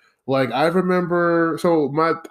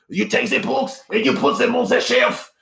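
put-on angry German accent; 10 semitones higher than usual. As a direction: bark orders in a hammed-up German accent. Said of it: "angry German guy".

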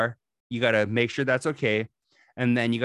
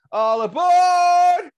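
a long, slow shout, much higher and louder; train conductor's call; 9 dB louder, much slower than usual. Retold or imitated. Imitating a conductor calling passengers aboard, drawn-out shout. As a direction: bellow it like a conductor calling down the platform. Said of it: "He's the one that goes".

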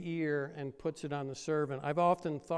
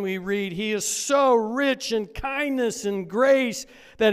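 higher and louder, slow in places; fervent scripture reading; 5 semitones higher, 8 dB louder than usual. Acted. Pastor reading Romans and Ephesians to the church, preaching loud. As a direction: proclaim the verses with mounting force. Scripture read with rising force.

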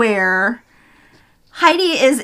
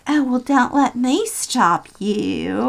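slow, softer mock Southern cat; southern accent; 5 dB quieter, slower than usual. Cat catches Heidi with the gun, bitchy Southern accent. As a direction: draw it out in an imitated Southern accent. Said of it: "she had this southern accent".

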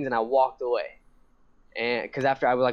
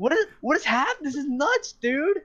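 incredulous freak-out; 13 semitones higher than usual. Retold or imitated. Retelling his freak-out in the back.

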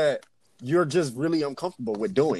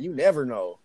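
ordinary delivery

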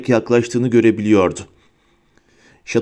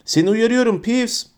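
shouted warning; 10 semitones higher than usual. A narrator's reading. McGonagall warns the prankster ghost, high contained shout. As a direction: warn him with pitch raised high. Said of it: "diye bağırdı Profesör McGonagall".

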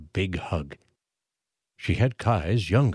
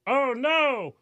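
high crowd voice crying out; yelled. A narrator's reading. A townsperson in the crowd yelling in alarm. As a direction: cry out in alarm. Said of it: "someone yelled".

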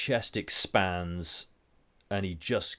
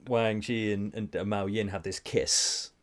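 ordinary talk

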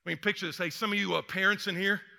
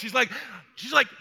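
ordinary talk

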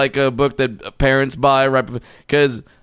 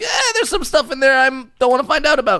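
high whiny near-crying voice; hammed-up squeamish whine; mock whimper; 13 semitones higher than usual. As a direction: whimper it like a scared kid. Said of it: "I still say, like".

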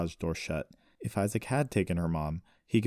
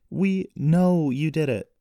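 slow, loud, high stress on "know"; emphatic correction; 10 semitones higher, 8 dB louder, slower than usual. A narrator's reading. Sister correcting accused brother, "know" stressed slowly and loudly. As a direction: correct him, leaning hard on "know". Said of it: "Jessie corrected".

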